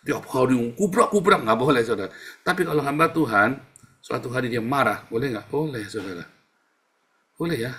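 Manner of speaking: ordinary delivery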